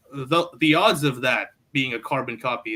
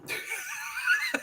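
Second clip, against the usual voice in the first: high squeal laugh; 8 dB quieter than usual. Real, not acted. Laughing at own joke.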